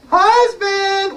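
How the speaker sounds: high falsetto call; giant's wife calling. Acted. Wife calling her husband, high-pitched holler. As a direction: sing out in a high wifely voice.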